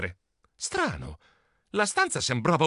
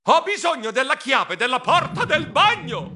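high, emphatic shouting; desperate yell; 10 semitones higher, 3 dB louder than usual. Acted. Mouse yelling in uncle's ear, desperate high shout. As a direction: hammer out the plea for deaf ears. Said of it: "gli urlai nell'orecchio disperato".

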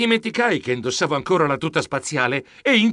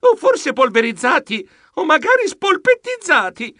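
high, escalating panic; comic alarm climbing to a peak; mock-dramatic doom; 10 semitones higher, 3 dB louder than usual. A narrator's reading. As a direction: build each fate higher, almost squealing. Narrator dramatises their coming doom.

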